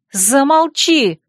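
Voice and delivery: выше и громче, медленно, «Замолчи!»; оборви его криком со слезами; отчаянный крик. Acted. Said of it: "вырвалась я". (отчаянный крик, медленно)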